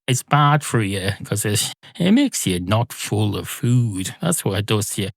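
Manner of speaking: ordinary talk, nothing marked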